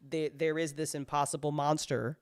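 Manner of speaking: ordinary delivery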